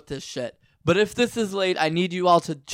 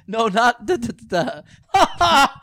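imitating aunt; 6 semitones higher, 4 dB louder, slower than usual. Imitating aunt's reply.

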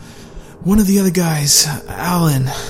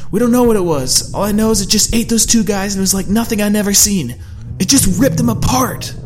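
higher, louder agitated voice; insist, still rattled; upset; 9 semitones higher, 4 dB louder than usual. A narrator's reading. Larry defending Allie, agitated.

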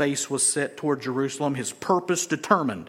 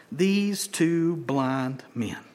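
slow emphasis; 3 semitones lower, 4 dB quieter, much slower than usual. Real, not acted.

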